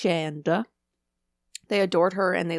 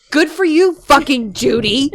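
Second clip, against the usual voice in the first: loud, sarcastic, slow on Judy; 7 semitones higher, 12 dB louder, slower than usual. Retold or imitated. Mocking a coworker with sarcastic praise, loud. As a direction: laugh it out mockingly. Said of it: "You're like".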